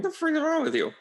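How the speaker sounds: shrill, high mock outrage at the dinner table. Family at the dinner table scolding, squealing in mock disbelief. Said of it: "at the dinner table going".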